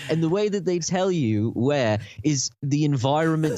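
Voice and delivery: a lightly faked British accent, laughing through it; mock British accent. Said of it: "talk with a British accent".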